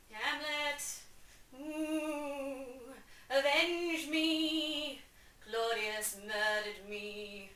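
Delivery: slow, drawn-out wailing ghost; spooky ghost. Retold or imitated. Father's ghost moaning to Hamlet for revenge. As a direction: moan it like a hammy ghost. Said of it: "the ghost is all like".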